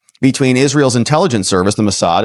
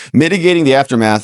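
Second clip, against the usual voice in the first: normal talking voice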